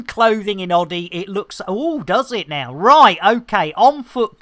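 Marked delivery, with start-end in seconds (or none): none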